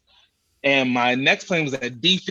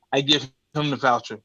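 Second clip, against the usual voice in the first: normal talking voice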